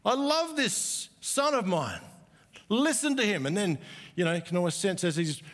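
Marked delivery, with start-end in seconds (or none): 0.0-3.5 higher and slower, thrilled and proud; excited Father's voice. Retold or imitated. Preacher voicing the Father at the transfiguration, excitedly proud. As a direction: announce your son like you can hardly contain yourself. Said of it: "sense the excitement in the Father's voice".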